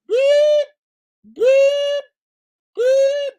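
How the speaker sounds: very high, loud mock reversing beeps; truck backup beeps. Teasing Brianna with piercing truck backup beeps. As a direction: beep like a reversing dump truck.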